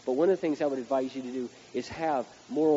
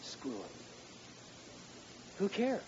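muttered aside; 4 semitones higher, 13 dB quieter, slower than usual. Shown as a demonstration. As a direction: throw it away under the breath. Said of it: "Let me say that again".

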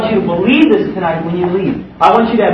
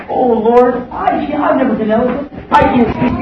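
Moses pleading; 6 semitones higher than usual. Retold or imitated. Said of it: "you know what he said".

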